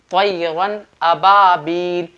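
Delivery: very slow तैरन, clear N, long vowels in अबाबील; measured recitation of तैरन अबाबील without nasal hum; izhar pronunciation. Shown as a demonstration. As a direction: stretch अबाबील, keep the N of तैरन distinct. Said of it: "आवाज़ को जाहिर करके पढ़ना है".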